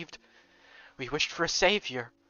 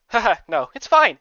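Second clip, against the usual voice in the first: loud, higher laughing denial; forced laugh; 6 semitones higher, 10 dB louder than usual. Acted. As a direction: laugh it off too hard.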